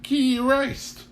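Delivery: high, slow, primly genteel; straining to sound refined for company; overly refined Mrs Kettle. Acted. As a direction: put on your finest parlor manners. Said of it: "only began two sentences with".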